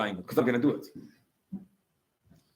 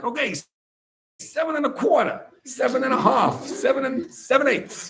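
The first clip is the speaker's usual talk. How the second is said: stalling countdown; 12 semitones higher than usual. Retold or imitated.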